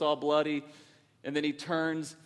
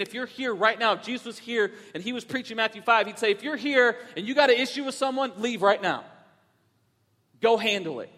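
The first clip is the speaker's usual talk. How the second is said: raised, pressing preacher's voice; preaching; 4 semitones higher, 3 dB louder than usual. Acted. Pastor urging his church to forgive, preaching. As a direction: urge the congregation to make things right.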